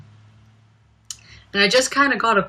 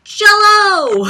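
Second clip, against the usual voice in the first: high, loud squeaky hello; mock greeting; 14 semitones higher, 8 dB louder than usual. Vlogger imitating how the sketch host always opens, high squeal. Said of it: "The way she would always start with that".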